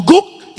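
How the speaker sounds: high, loud mock woof; imitated dog bark. Preacher demonstrating dog language to the congregation, imitated bark. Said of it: "kalau ada anjing ngomong".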